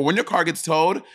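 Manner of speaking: ordinary talk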